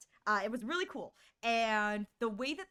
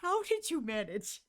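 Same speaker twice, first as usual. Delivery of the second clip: rising to a high, strained, near-crying pitch; building exasperated rant, high and teary; 5 semitones higher than usual. Retold or imitated. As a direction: disbelief building to a squeak. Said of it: "I'm like".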